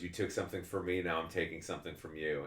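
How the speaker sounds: low, quiet villain threat; dark menacing turn. Acted. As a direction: hiss it like a vengeful villain. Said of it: "have a dark turn".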